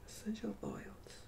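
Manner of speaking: soft whisper, no voice. Shown as a demonstration. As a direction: breathe the words in a whisper.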